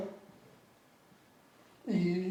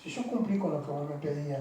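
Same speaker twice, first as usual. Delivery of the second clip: a mild hint of crying, much softer; retold tearful plea; 3 semitones lower, 9 dB quieter than usual. A man retelling his crying prayer to God, softly pleading. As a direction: plead with God quietly, as if through tears. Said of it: "donde llorando le dije".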